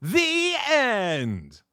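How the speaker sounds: high, bright "The end!"; grand announcement. Acted. Closing the episode for viewers, high and showy. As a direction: announce it like a finale. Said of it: "announcing end of chapter".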